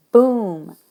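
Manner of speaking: loud, booming sound word; big loud boom, demonstrating the sound; onomatopoeic boom. Shown as a demonstration. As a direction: make the word explode like the noise. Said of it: "words that sound like that".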